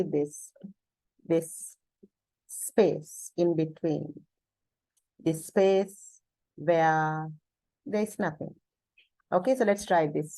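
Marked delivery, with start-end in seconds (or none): 0.0-8.5 retelling a teacher slowing down, words spaced further and further apart; a calm teacher's demo of widening silences between words; growing pauses. Retold or imitated. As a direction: let the gaps between words stretch out more and more. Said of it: "I'm going to deliberately start increasing the pause between words".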